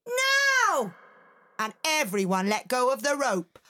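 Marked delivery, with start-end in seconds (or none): 0.1-0.9 high, sharp shouted command; girl shouting the signal; shouted now. Acted. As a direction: yell the signal to let go. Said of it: "Felice shouted".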